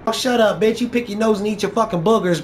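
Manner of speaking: normal talking voice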